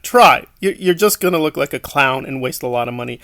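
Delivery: ordinary delivery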